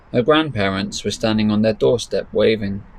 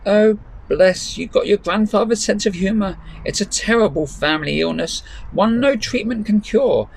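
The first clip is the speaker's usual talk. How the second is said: put-on much higher old granny voice, wry; joking gran; 9 semitones higher than usual. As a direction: grandmother joking back at her granddaughter. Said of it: "said Grandma".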